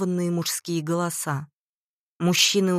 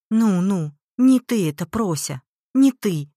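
higher, louder, insistent reassurance; urgent reassurance; 3 semitones higher, 6 dB louder than usual. A narrator's reading. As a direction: insist to a distraught daughter she is blameless. Mother consoles distraught daughter. (горячо успокаивая)

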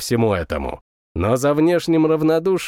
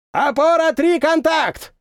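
shouting; 14 semitones higher than usual. A narrator's reading. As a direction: call it out over the roar. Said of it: "прокричал".

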